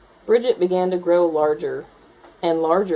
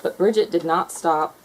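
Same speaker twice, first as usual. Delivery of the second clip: usual speaking voice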